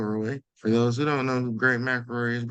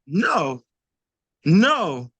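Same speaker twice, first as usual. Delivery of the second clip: each No louder and higher; insistent, growing no; building emphatic no; 5 semitones higher, 7 dB louder, much slower than usual. Real, not acted.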